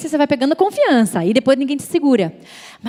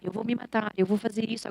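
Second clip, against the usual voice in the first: very soft imitated thought; disguised inner voice; 9 dB quieter than usual. Dark voice posing as her own thoughts, hushed imitation. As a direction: murmur it as if inside your head. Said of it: "Antes era".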